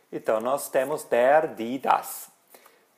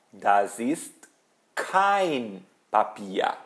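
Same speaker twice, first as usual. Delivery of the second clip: slow, with "kein" set apart and stretched; deliberate teacher's negative example; model negation; 2 semitones lower, much slower than usual. Shown as a demonstration. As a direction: pause before "kein" and draw it out. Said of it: "Eu coloquei a letra K".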